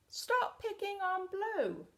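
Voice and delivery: high, light character voice; Yellow's voice. Acted. Yellow not saying it to Red, high voice.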